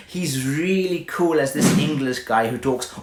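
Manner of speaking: usual speaking voice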